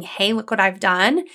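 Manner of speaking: ordinary talk, nothing marked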